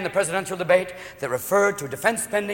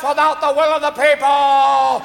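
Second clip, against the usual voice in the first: high, impassioned stammering-hero impression, swelling loud; Jimmy Stewart; 6 semitones higher, 4 dB louder than usual. Senator turned movie hero scolds the President, impassioned cry. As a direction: plead high and loud, stretching out the finish. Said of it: "It's Jimmy Stewart going".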